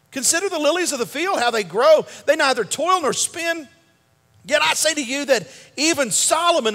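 preaching scripture. Acted. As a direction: build the Bible reading into a sermon climax. Reads scripture with rising force.

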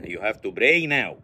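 slow, low English with b for p in pray; mispronounced English. Acted. Man ending a meeting to pray, mispronounced English. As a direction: PRAY said as "bray". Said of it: "pray يعني ينهق يا حبيبي".

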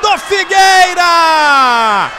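long held goal scream. Real, not acted. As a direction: stretch the goal call out, full voice. Goal call, long held shout.

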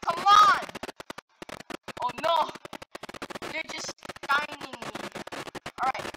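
normal talking voice

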